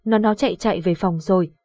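usual speaking voice

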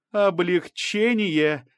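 high, drawn-out accusing word; slow, insistent accusation; raising the voice. A narrator's reading. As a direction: press the word home, voice rising. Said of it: "возвышая голос".

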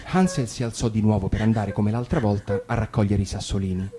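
ordinary delivery

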